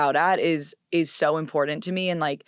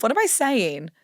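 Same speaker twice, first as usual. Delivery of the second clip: ordinary talk, nothing marked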